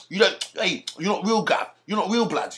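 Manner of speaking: high-pitched London street-youth voice; mock London street slang. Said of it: "they will speak like that".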